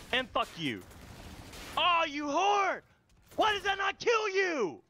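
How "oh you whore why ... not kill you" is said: high, exasperated yelling; outraged protest. Real, not acted. Player whose enemy won't die, outraged yell. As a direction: complain at the top of the voice range.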